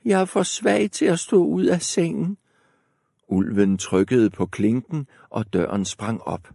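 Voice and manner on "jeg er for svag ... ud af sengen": put-on high frail old woman; grandmother voice, much higher. A narrator's reading. Grandmother calls through the door, high old voice. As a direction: quaver it like a granny. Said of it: "sagde bedstemoren".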